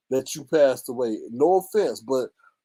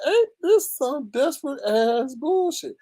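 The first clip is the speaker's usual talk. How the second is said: scornful quote; 10 semitones higher, slower than usual. Retold or imitated. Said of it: "I was like".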